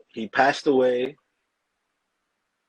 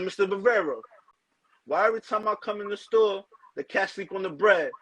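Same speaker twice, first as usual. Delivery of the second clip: customer complaint; 7 semitones higher than usual. Retold or imitated. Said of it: "I said".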